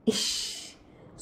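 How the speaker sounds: bashful hushed shush-like exclamation; long, whispered, coy exclamation; sweetly bashful. A narrator's reading. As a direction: breathe it out softly, drawn out and shy. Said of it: "Suman अतिशय मधाळ लाजली".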